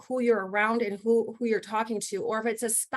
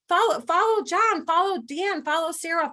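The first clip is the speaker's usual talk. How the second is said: louder, higher rallying cry to the team; rallying call; 6 semitones higher, 4 dB louder than usual. Real, not acted. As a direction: fire up the team to follow their leaders. Rallying team to follow leaders.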